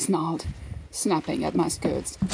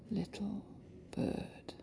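soft hushed words; softly; 16 dB quieter, slower than usual. A narrator's reading.

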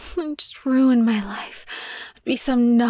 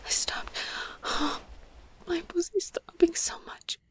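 much softer, strained whisper; 3 semitones higher, 10 dB quieter than usual. Acted. Telling her partner she stopped, whispered. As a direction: breathe it out barely voiced.

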